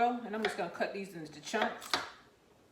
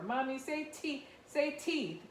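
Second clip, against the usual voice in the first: put-on teasing child; 7 semitones higher, slower than usual. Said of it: "I used to make fun of her".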